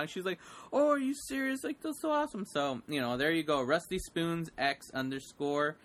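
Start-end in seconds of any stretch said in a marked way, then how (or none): 0.7-2.4 put-on high young-fan gushing; high-voiced, disbelieving delight; retold excitement. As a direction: imitate a thrilled young girl, pitched way up. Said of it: "she just got so excited".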